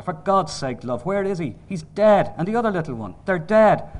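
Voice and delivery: heated, insistent father; exasperated arguing. Acted. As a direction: argue it hard with a grieving partner. Father argues with grieving mother.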